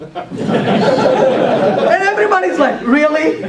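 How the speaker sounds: real laughter through the words; laughing while retelling the room's reaction; retelling a student's answer, laughing. Real, not acted.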